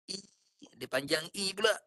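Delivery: ordinary talk, nothing marked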